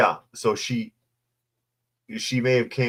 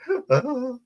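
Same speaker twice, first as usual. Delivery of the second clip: hammed-up high mock sob. Mock sob after quote.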